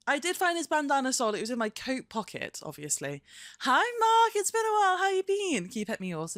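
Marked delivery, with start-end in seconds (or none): 3.6-5.7 very high, loud greeting voice; pitched-up sing-song hello; pet talk. Real, not acted. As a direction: coo hello to the dog. Greeting the dog.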